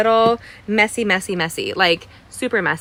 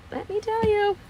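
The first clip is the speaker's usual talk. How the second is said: the same phrase again, much higher and mock-dramatic; high-pitched repeat; 12 semitones higher, 3 dB quieter than usual. Real, not acted. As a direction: jump way up in pitch on the echo. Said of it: "repeated for effect".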